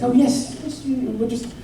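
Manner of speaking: lightly voiced breezy British mum, rushed; a touch of a posh mother brushing it off. Mum insisting to her teenage son, lightly posh and quick. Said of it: "very British".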